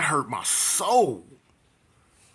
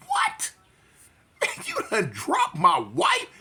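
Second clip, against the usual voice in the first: very high what then slow, stunned; can't believe they hit her; outraged disbelief; 11 semitones higher, slower than usual. Retold or imitated.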